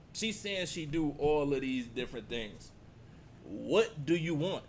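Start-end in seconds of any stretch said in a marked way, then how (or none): none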